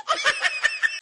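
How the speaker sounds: high, cackling villain laugh; high scheming cackle; gleeful villain cackle. Acted. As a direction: cackle like a scheming daughter-in-law. Gloating over lost phone.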